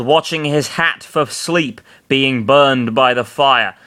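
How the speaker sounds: usual speaking voice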